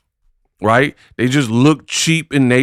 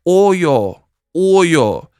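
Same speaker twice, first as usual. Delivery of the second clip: mock New York accent, pitched higher; Clarissa Shields's New York accent; 5 semitones higher than usual. Vlogger mimicking a boxer's sudden New York accent, mocking higher-pitched impression. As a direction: exaggerate a borrowed New York accent in mockery. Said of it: "how do you have this accent where she's like".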